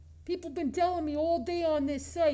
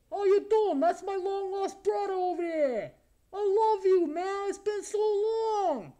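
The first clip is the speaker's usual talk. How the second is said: overjoyed reunion; 4 semitones higher than usual. Pretend. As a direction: gush at a long-lost brother, drawing out the end. Greets his supposed long-lost brother.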